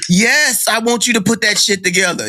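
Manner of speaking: high-pitched, emphatic mock exasperation; exasperated retort. Customer answering movers about reassembling furniture, exasperated.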